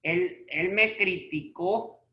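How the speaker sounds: "criticó" as cri-ti-CÓ, slowly; stress on the final ó. Shown as a demonstration. Teacher giving an example to students, slow "criticó" stressed on CÓ. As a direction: land on the last syllable of "criticó". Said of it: "Lleva en la O".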